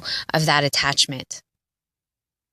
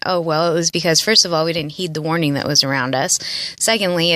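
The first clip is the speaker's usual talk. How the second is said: normal talking voice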